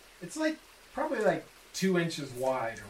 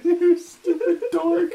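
cracking up mid-sentence; words squeezed out through laughter; laughing; 8 dB louder than usual. Real, not acted. Laughing fit mid-line.

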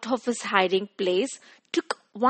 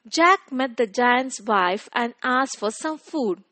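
ordinary delivery, nothing marked

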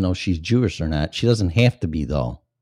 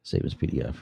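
unvoiced, very soft whisper; 8 semitones lower, 11 dB quieter, faster than usual. Shown as a demonstration. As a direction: breathe the words under your breath. Repeats the line under breath.

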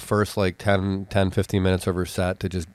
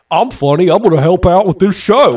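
higher, louder, smug self-belief; mock cocky drunk; 10 semitones higher, 9 dB louder than usual. Drunk guy boasting he will save the show, hammed-up cocky. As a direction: puff up like a self-declared comic. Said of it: "I think he took that as".

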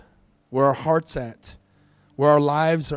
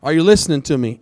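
louder, pressing sermon warning; urgent warning; 3 semitones higher, 8 dB louder than usual. Real, not acted. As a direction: drive the danger home.

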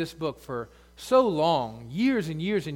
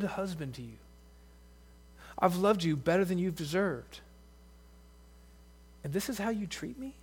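wounded husband; 5 dB quieter than usual. Pretend. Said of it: "God is saying to her".

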